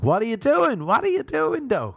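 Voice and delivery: very high, pushy Irish host voice; Irish accent. Retold or imitated. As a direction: press for gossip in a Belfast accent. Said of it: "That's the only accent, Belfast or Dublin".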